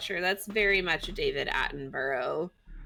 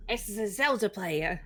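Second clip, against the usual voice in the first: Steve Irwin; 2 semitones higher than usual. Acted. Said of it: "if Steve Irwin, it has to sound like".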